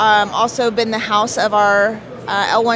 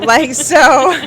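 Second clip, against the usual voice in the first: laughing; 3 semitones higher, 6 dB louder than usual. Real, not acted. Laughs at own admission.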